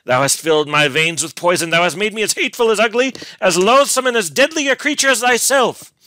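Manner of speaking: loud, high furious denunciation; beside himself with passion. A narrator's reading. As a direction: rage at full cry, relentless. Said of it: "feeling beside himself with passion".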